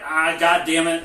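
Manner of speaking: exasperated quote. Retold or imitated. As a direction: groan out the curse as a grudging character. Acting out Carlos's reluctant curse.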